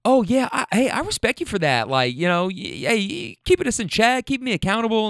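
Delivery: high fake-nice mimic; squeaky sucking-up imitation; mimicking her flattery, high and syrupy; mocking her voice. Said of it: "be like".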